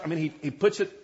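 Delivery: ordinary talk, nothing marked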